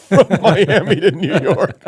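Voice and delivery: laughing through the words; barely holding in laughter. Real, not acted. Tells absurd punchline while laughing.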